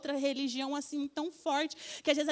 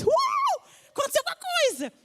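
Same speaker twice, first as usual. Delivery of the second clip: much higher, louder squealed cry of amazement; excited squeal; 13 semitones higher, 4 dB louder than usual. Retold or imitated. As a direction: squeal it like an overjoyed teenager. Friends reacting to a miracle.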